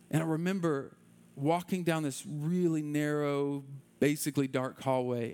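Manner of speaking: ordinary delivery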